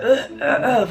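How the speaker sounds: mock vomiting noises; imitated retching. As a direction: mimic throwing up, half laughing.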